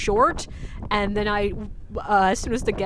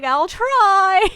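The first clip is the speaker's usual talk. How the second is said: high, loud, drawn-out reply; strained call back; 9 semitones higher, 9 dB louder than usual. Retold or imitated. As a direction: imitate a struggling guide calling back, stretching the last word. Struggling friend calls back.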